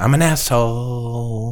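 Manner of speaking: a light, slow, quiet hint of an angry Boston comedian; mock the angry comic's line, drawn out slowly. Co-host lightly mimics an angry Boston comedian, slow and quiet. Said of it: "the angry guy that used to".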